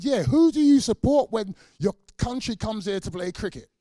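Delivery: high, loud taunting question; loud mock challenge. Quoting officials' cricket test.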